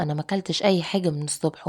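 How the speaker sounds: ordinary delivery, nothing marked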